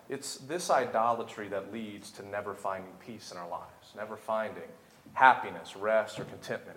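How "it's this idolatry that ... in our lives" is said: normal talking voice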